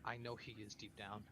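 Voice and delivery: soft, subdued confiding; hushed in-character concern; quietly worried. Acted. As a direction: say it low-key, as a private worry. Worried character confiding about someone.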